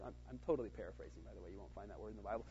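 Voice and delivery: ordinary talk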